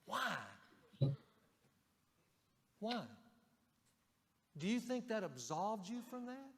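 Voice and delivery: sharp rising why, pressing hard; incredulous challenge. Retold or imitated. Pastor confronting a passive husband, incredulous. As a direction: challenge a man who dodges his role. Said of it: "lean forward across the table and go".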